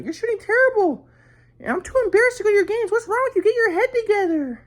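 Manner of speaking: very high-pitched mock mom scolding; mom yelling. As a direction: imitate a mother screeching criticism in a falsetto. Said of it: "my mom was, like, yelling at me".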